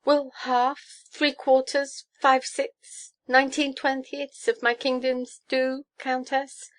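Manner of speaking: high, anxious haggling; high-pitched nervous pleading; trembling. A narrator's reading. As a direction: raise each offer desperately, pitch held high. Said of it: "asks the trembling monarch".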